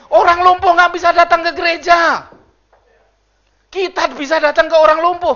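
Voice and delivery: loud, high preaching voice; preaching at full force. Acted. Pastor booming to the congregation, loud and high. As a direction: preach it out to the church.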